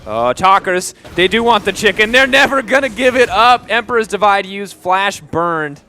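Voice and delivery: high, loud play-by-play hype; excited casting. Real, not acted. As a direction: ride the fight's rising action. Caster hyping the fight.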